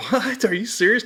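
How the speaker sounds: high, incredulous what; retold surprise. Recruit hearing he got bitten, high retold disbelief. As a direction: reenact his shock at hearing it.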